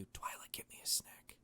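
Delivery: unvoiced, hushed request; a hushed plea for food; whispered aside. Real, not acted.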